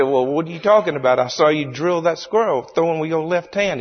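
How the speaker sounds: high, baffled mock-Cajun protest; Cajun storyteller. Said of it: "tells the story in his hilarious Cajun accent".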